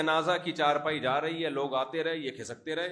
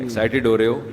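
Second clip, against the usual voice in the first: teasing doctor; 4 semitones lower, 6 dB louder than usual. Retold or imitated. Doctor teases angry old man.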